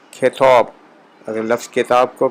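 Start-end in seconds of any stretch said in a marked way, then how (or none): 0.1-0.7 کھیتوب said with aspirated kh and o vowel; Persian kh sound. Shown as a demonstration. Teacher demonstrating Persian pronunciation of کتاب, aspirated kh. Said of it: "کھے کی آواز ڈال کے".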